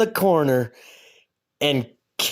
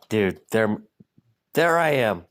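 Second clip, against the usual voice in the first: normal talking voice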